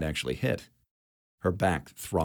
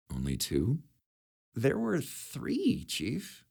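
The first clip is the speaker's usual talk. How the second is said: soft, strained voice; damaged voice; 6 semitones higher, 4 dB quieter, much slower than usual. A narrator's reading. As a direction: speak through hurt lungs. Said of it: "talking through a fan".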